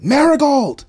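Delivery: piercing high full-force yell. A narrator's reading. Refrigerator yelling at Marigold, high violent shout. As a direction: scream the name out, sudden and huge. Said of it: "The refrigerator yelled".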